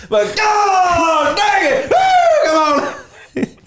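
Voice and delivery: very high, slow whooping drawl; country guy shout. Retold or imitated. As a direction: hoot it out like a rowdy cowboy. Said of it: "He's like".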